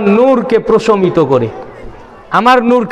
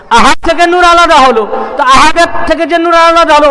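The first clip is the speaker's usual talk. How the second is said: raised, ringing sermon voice, driving each line; fervent preaching; 4 semitones higher, 3 dB louder than usual. Acted. Preacher declaring God's oneness to the congregation, fervent and raised. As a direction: preach it out to the gathering, building fervour. Preacher back at full force.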